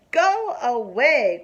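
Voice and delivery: slow, loud go away; mock shout. A narrator's reading. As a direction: shoo her off loudly and slowly. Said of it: "cried Froggy".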